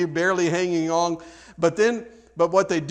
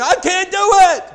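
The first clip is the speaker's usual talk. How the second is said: high, loud hammed-up child's wail; mock tantrum scream; 11 dB louder than usual. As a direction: scream it like a toddler melting down. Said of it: "kicking, screaming".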